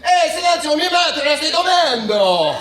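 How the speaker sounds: hammed-up passionate Italian. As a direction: yell it like an excitable Italian. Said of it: "it's always so passionate".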